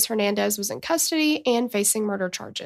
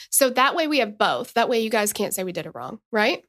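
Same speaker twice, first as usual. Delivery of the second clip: normal talking voice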